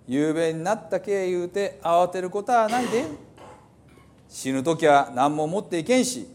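put-on old Hiroshima dialect. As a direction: declaim the psalm like an old Hiroshima elder. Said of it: "ちょっと古い広島弁ですね". (古い広島弁で朗読)

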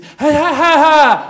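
loud, high "ha-ha" cries; imitated toddler babble. Father mimics son who can't talk yet, high babbling cries. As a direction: yelp in bursts like an eager toddler. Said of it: "He can't talk yet".

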